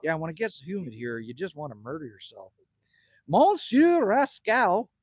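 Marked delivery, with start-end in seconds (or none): 3.3-4.9 slow, higher, louder hammed-up French accent; mock French accent. Greeting a viewer named Rascal in a mock French accent. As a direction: stretch the name out in a jokey French accent. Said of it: "I said that in a Frenchy fried voice".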